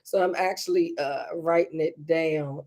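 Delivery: ordinary talk, nothing marked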